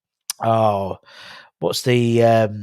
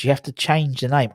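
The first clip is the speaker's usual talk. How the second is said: normal talking voice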